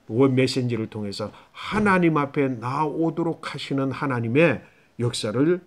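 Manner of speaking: ordinary talk, nothing marked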